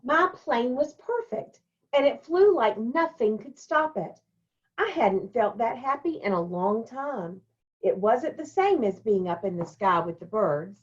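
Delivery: put-on brighter storytelling voice for the children; story reading. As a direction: read it like a picture book to kids. Teacher reads picture book aloud.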